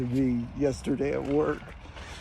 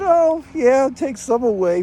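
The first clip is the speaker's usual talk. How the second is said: breezy dismissal; 8 semitones higher, 5 dB louder, slower than usual. Retold or imitated. Retelling his dismissive reply.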